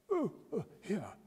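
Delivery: startled timid reply; nervous young Winkle. A narrator's reading.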